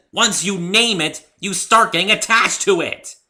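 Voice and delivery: loud, high, slowed warning; scolding. Acted. Rainbow Dash scolds Pinkie for naming him, loud and firm. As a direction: lecture her firmly.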